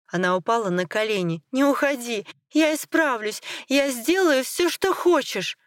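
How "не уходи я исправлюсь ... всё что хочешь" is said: high-pitched, frantic begging; desperate pleading. Acted. Wife on her knees begs him to stay, desperately. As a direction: beg with the pitch climbing.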